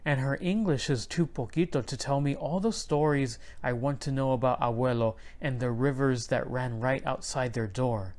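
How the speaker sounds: usual speaking voice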